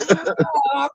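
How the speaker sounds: high laughing honk; mock honk. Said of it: "and said".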